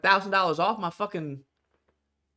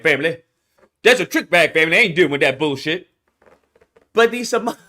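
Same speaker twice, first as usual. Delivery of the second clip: normal talking voice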